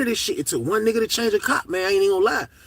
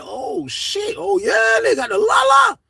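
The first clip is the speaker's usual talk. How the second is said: high, loud disbelieving yell; excited shout; 14 semitones higher, 3 dB louder, slower than usual. Retold or imitated. Hearing friends got the Lala, excited shout. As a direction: shout it out in shocked glee. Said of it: "I'm like".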